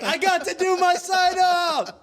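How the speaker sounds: high, frantic inner voice; high frantic rush; panicked. Retold or imitated. Panicked inner thought retold.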